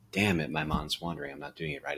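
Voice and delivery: mock annoyed inner voice; frustrated self-scolding. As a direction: scold yourself for losing focus. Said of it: "And it's not like a".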